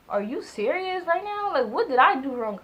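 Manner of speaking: stunned she got hung up on; disbelief. Retold or imitated. Girlfriend retelling her shocked reply to a boyfriend who hung up.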